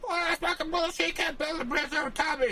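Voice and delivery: very high, fast whining complaint; whiny rival. Retold or imitated. Map-maker voices an annoyed rival whining about the bridge. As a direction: whine up high like a sore loser.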